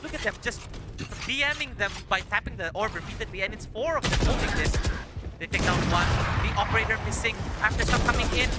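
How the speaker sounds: building play-by-play call; rising excitement as the fight unfolds; esports caster. Acted. Live play-by-play of a round.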